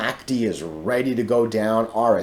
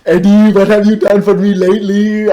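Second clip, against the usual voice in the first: much higher and much louder mock-comic put-on voice; Eddie Murphy voice; 12 semitones higher, 13 dB louder than usual. Vlogger acting out the Eddie Murphy joke, loud high mock voice. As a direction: belt out the movie line like the comic's character. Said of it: "he does it in a real deep African, uh, voice".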